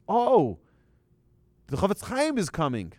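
high, loud burst of retold excitement; awed excitement. Passenger telling a stranger about the famous rabbi, excited. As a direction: exclaim it as thrilling news.

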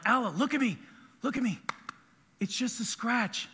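put-on a touch of a parent soothing a crying child; reassuring parent. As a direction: settle a hurt kid down gently. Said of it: "get down on their level".

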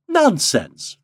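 high, loud, light-hearted dismissal; mildly gleeful exclamation; merry cry. A narrator's reading. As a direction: brush the worry off gaily. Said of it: "cried Helen Wingate merrily".

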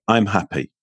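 "I'm happy" low and falling, sounding unconvinced; downward intonation. Shown as a demonstration. Repeating the demo for the host, falling tune on "I'm happy". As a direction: let "I'm happy" sink down dully. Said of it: "what's your gamble".

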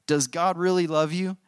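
serpent's teasing high voice; insinuating serpent. A narrator's reading. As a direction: repeat it high, sowing doubt. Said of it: "the serpent whispered".

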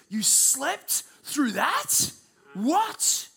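very slow, high incredulous question; incredulous friends. Retold or imitated. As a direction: drag it out in disbelief. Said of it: "they would say this".

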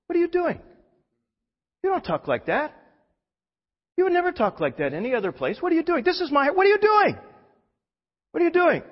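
very high, pointed scolding as Jesus; high-pitched rebuke of a wife-like Jesus; Jesus sounding like wife. Retold or imitated. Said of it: "his voice sounded an awful lot like Judy's".

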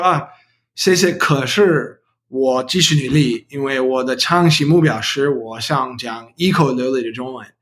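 American-accented learner Mandarin; careful second-language Mandarin, English accent; foreigner speaking mandarin. Real, not acted. Non-native learner speaking Mandarin throughout.

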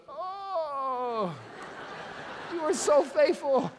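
high, slow, laughing mock praise; mock gushing praise with laughter. Pastor mocking a too-safe prayer to God, laughing. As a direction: gush in mock wonder then laugh.

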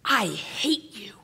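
slow, hissed whisper; mock-venomous child, drawn out under the breath; imitated child's anger. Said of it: "be like".

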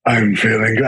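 sultry act about to be dropped; put-on sultry. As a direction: play the smooth seducer, pitched low. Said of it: "I can't keep this up".

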